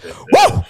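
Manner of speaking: high, loud celebratory whoo; hype whoop. Real, not acted. Host cheers with listeners, loud excited whoop. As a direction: let out a big fired-up whoop. Hype after soundboard clip.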